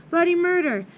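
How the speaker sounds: high, loud mock scream. A narrator's reading. Narrator tells of Amanda being swung, mock screaming. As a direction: call it out high, half in earnest. Screaming named around these words.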